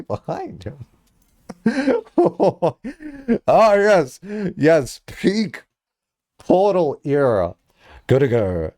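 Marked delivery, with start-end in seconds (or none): 1.6-7.6 laughing excitedly. Real, not acted. Laughing at leaderboard find.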